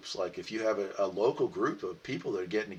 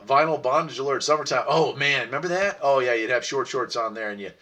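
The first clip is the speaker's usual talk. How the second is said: usual speaking voice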